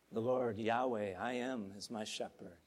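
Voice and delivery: low, soft reverent declaration of the psalm; solemn scripture recitation. Acted. Pastor closing his sermon with a hushed, reverent psalm. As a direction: speak the psalm softly, like a vow.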